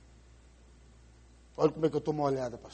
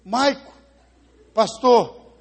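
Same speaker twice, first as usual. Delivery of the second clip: a louder, stuck repetition of Maico, pastor; broken-record grandmother; 6 semitones higher, 13 dB louder, much slower than usual. Acted. Ficou várias vezes igual um disco.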